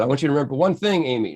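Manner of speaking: imitated Aussie instructing earnestly; Australian accent. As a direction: attempt an Australian accent, urging her. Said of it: "try to do an Australian accent".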